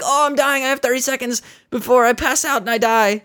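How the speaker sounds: high mock panic, a dying caller. Dying caller pleading with phone menu, high mock panic. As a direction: play the caller losing it, hammed.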